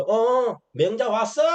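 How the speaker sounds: king greeting Mencius carelessly while tending his pets. Retold or imitated. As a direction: toss it off without interest. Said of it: "성의 없이 돌아보면서".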